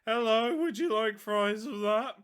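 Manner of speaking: high, loud cracking voice; mock voice crack. Host mocking own crack as fries server, high squeaky voice. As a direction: put on a squeaky breaking voice, hammed-up. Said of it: "Voice crack".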